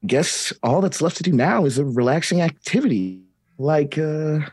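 put-on a touch of children's TV host brightness; lightly cheery kids' host. [name] telling the kids it is time to relax, gently cheery. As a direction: chat sweetly to the kids.

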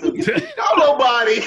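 high, slow, shouted protest; hammed-up loud disbelief; outraged denial. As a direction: holler it in mock outrage. Acting out outraged denial to wife.

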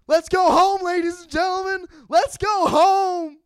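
high, full-force yell to the crowd; rallying shout. Acted. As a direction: belt it out like a hype man, stretching the last word. Sign-off yell before season starts.

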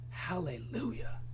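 breathy whispered Hallelujah. Real, not acted. Preacher whispering praise after laughing. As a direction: hush it out in awe.

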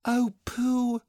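put-on high, childlike cry of concern; high-pitched, drawn-out call. A narrator's reading. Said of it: "cried Christopher Robin".

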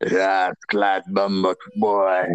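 low, drawn-out Jamaican growl; playful deep Jamaican patois; mock Jamaican. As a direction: slowly ham up a deep Jamaican accent. Mimicking Jamaican curses as a joke.

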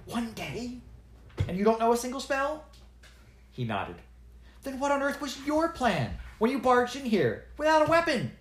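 can't believe what you're hearing; girl exasperated with the dwarf. Acted. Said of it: "Now Zinnia was exasperated".

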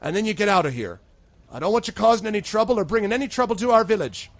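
loud, high warning from the constable; stern constable. Acted. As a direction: order the strangers out of the village. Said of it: "then looks back at all of you".

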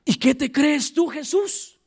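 sneering, offended priest voice, lightly done; mocking challenge. Preacher voicing the priests scolding Jesus, mild sneer. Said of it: "como quien dice".

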